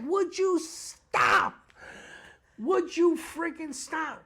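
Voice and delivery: high, drawn-out mock plea; loud, high-pitched exasperation; exasperated wife. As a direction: imitate a fed-up wife, stretching the words. Imitating his exasperated wife.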